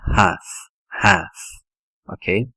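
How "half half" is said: "half" as HAF, silent l; correct English "half". Shown as a demonstration. Teacher modelling "half" with a silent l. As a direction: drop the l in "half". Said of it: "é half".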